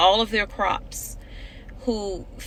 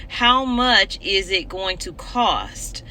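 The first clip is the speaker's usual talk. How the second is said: slow, deliberate; slower than usual. Real, not acted. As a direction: slowly spell out "How much is it going to cost?". Repeats question slowly for emphasis.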